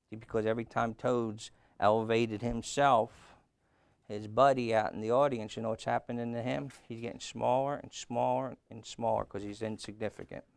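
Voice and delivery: ordinary delivery, nothing marked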